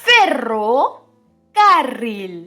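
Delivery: ordinary talk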